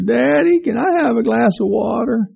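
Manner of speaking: put-on a child asking again hopefully; little boy calling again from bed.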